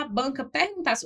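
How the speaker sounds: ordinary delivery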